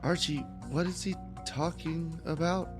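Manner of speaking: normal talking voice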